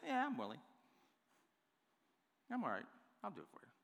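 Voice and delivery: offhand, indifferent agreement; casual, half-hearted. Shown as a demonstration. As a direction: toss it off softly like a reluctant favor. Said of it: "I don't believe he says it like this".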